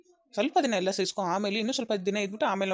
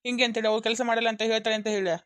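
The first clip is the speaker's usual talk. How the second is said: ordinary talk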